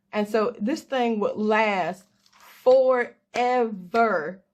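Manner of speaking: the word "forever" stretched very long and slow; drawn-out forever. Real, not acted. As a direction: drag out "forever" slowly for emphasis. Stretching out how long it lasts.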